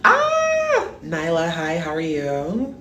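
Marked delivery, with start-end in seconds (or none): 0.0-0.9 very high, sharp squeal; squealing in delight. Real, not acted. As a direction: let out a sudden shriek. Sudden squeal on stream.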